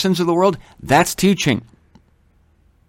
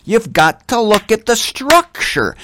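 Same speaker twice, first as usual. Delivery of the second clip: higher, slower teacher's maxim; imitating teacher Clay Kent; 7 semitones higher, slower than usual. Preacher quoting his old teacher, higher and slower. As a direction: mimic the old teacher drumming it in. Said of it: "he always used to say".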